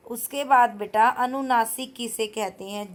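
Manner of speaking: usual speaking voice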